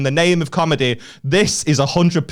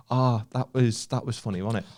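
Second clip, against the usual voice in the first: soft, low murmur, acted; hushed audience aside; 4 semitones lower, 8 dB quieter than usual. Audience member turns to a friend, hushed. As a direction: lean in for a private remark.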